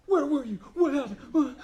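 put-on sharp, high, frantic questions at a found child; angry parent. Parent who lost a child at Legoland, angry and high. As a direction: demand it like a scared, angry parent. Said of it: "Yeah, you're angry".

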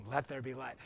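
soft low murmured command; quiet, low God voice. Retold or imitated. God creating the world, quietly and low. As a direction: say it softly, low and calm. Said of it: "Was that like".

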